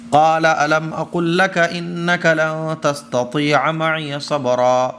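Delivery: slow, melodic Arabic recitation; Quran recitation. Acted. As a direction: chant the verse with long held vowels. (دھیمی ترتیل سے تلاوت)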